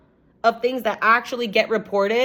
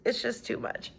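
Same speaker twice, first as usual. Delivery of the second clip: weary whisper; 4 semitones higher, 11 dB quieter than usual. Real, not acted. Overwhelmed by trafficking videos.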